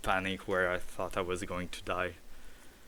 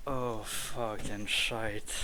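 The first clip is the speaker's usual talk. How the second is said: drained relief; 4 dB quieter, much slower than usual. Real, not acted. Exhausted relief.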